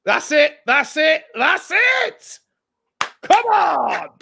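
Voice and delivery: high, loud, rising cheers; excited cheering. Real, not acted. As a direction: celebrate the call. Card finally given, excited.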